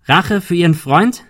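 accusing call across a distance. A narrator's reading. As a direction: shout it as a rising question. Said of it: "rief Ben".

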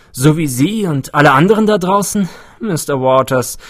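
lively researcher explaining himself; eccentric older scientist, untroubled. A narrator's reading. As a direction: brush off the visitor's fears.

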